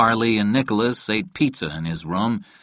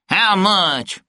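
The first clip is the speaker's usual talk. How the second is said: sharp, high-pitched demand; insistent question at a raised pitch; 10 semitones higher, 3 dB louder than usual. A narrator's reading. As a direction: demand an answer, voice jumping up high. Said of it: "Jankel demanded".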